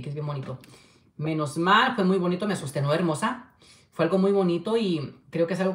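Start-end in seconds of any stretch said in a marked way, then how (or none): none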